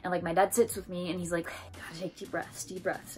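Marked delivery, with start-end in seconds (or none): 1.5-3.2 hushed, much softer coaching voice; soothing dad. Retold or imitated. Dad sitting with injured child, hushed calming. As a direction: imitate a dad quietly talking someone through breathing.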